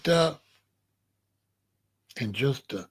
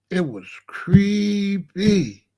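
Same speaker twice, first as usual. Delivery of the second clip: drawn-out creepy; much slower than usual. Real, not acted. Drawing out how creepy it was.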